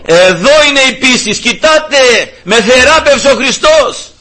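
father proclaiming. Retold or imitated. Father boasting loudly to the villagers about his healing. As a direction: call it out high to the village. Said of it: "έλεγε στο χωριό".

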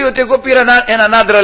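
high, loud mock grievance; exasperated protest. Wife tells judge about her husband, exasperated protest. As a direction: imitate a wife pleading her case loudly.

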